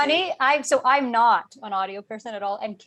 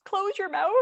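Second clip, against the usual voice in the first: mock sister request; 8 semitones higher than usual. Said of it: "And she's like".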